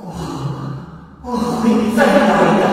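eerie high, slow spirit voice; trapped fox spirit. Acted. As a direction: wail from inside the bottle. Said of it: "有人说道".

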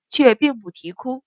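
ordinary delivery, nothing marked

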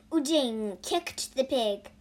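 plain reading voice

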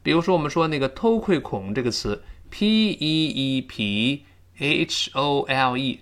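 normal talking voice

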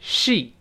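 Sheet with a long ee, softly; model word. Shown as a demonstration. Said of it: "跟老师读一下". (示范 sheet 长元音)

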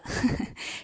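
轻轻一声气笑; 忍不住笑出一声. Acted. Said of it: "菩萨乐了". (菩萨被逗乐，轻声一笑)

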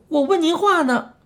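high-pitched impatient protest; impatient child. A narrator's reading. Child ignored by the old man, impatient. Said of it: "怪老头假装没听见".

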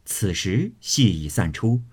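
usual speaking voice

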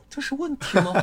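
laughing in disbelief; incredulous laugh. Retold or imitated. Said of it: "我说".

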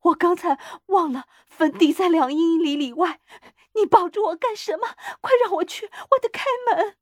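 high, loud, frantic pleading; tearful cry. A narrator's reading. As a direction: struggle to break free, crying out high. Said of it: "他哭喊着说". (激动地高声哭喊)

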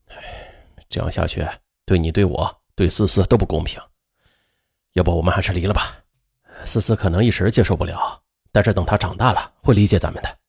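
low, soft, heavy-hearted; weary and sad. A narrator's reading. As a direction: sigh it out, resigned. Husband sighs, proposes divorce.

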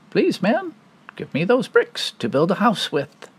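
high squeaky pig voice; little pig voice. Acted. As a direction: play the polite little pig. Pig's voice, high.

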